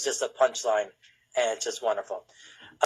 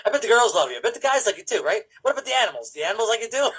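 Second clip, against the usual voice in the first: lightly imitated photographer coaxing a model, higher pitch; talking to a model; 8 semitones higher, faster than usual. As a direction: play at flattering a model, pitched up. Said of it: "like he's talking to a model".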